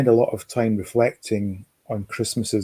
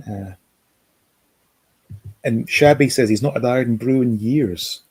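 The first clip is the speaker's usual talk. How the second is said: usual speaking voice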